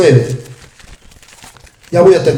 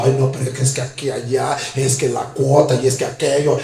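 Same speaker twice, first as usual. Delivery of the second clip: mock grumbling; 4 semitones lower, 4 dB quieter than usual. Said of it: "Y nosotros renegando".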